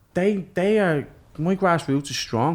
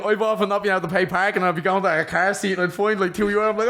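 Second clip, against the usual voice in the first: usual speaking voice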